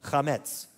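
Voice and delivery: chametz with a throaty ch at the start, stress on the last syllable; teacher models chametz for the class; Hebrew pronunciation. Shown as a demonstration. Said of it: "Can you say that?".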